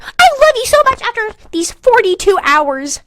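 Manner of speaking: high hammed-up swoon; mock lovesick voice. Mocking the lover who fell for her in two days. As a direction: gush like a smitten teen. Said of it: "is he like".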